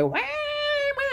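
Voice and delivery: high wailing wah wah; mock baby cry. Imitating the baby crying in the crowd, very high.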